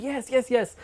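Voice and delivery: mock over-eager agreement; half-playful rush of yeses. Eager reply to offer of tapes.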